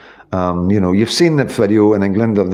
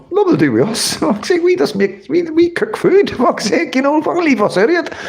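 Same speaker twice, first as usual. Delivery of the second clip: much higher, slowed, laughing caricature voice; mock Chinese voice. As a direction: squeak it out in a high mock voice between laughs.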